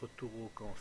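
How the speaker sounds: ordinary talk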